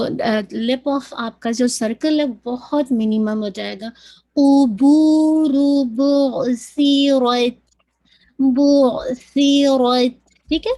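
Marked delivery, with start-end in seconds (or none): 4.3-10.3 قُبُورُ بُعْثِرَتْ drawn out with a clear عین; slow careful recitation. Shown as a demonstration. Teacher reciting the verse slowly for students. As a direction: give the عین in بُعْثِرَتْ its time. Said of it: "make sure کیجیے گا عین ہے".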